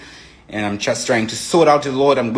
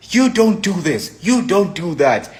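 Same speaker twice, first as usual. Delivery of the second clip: fierce scolding; 7 semitones higher than usual. Retold or imitated. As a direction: lay down the law. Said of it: "so defined".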